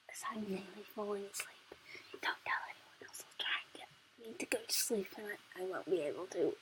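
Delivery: sleepy secretive whisper. Real, not acted. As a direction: whisper it half asleep. Sleepy secret told to the camera.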